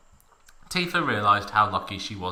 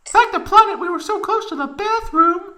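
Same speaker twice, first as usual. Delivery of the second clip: squeaky falsetto Tifa, louder; a shrill cheerful girl's voice; giggly high Tifa; 8 dB louder than usual. A narrator's reading. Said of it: "she giggled".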